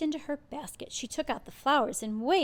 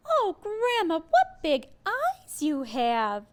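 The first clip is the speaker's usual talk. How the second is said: put-on high, slow girlish wonder; 6 semitones higher, 6 dB louder, slower than usual. A narrator's reading. Girl staring at grandma's eyes, high and slow. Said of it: "said Little Red Riding".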